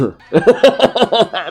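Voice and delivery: high, loud laughing burst; real burst of laughter. Real, not acted.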